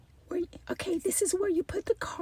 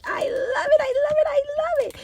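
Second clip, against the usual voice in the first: high, loud gushing I love it; gleeful shouting; 9 semitones higher, 9 dB louder, faster than usual. Real, not acted. Gushing to viewers over the sunflower cup, loud delight.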